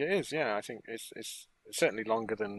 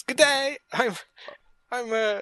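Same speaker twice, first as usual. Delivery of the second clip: a lightly voiced Villa, pitched up and louder; 9 semitones higher, 4 dB louder, slower than usual. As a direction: mimic Villa greeting the guards, half playful. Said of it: "when he speaks to those guards and says".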